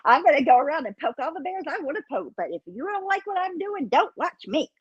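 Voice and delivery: mocking bratty imitation. Mocking Starlight's attitude.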